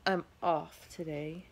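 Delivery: ordinary delivery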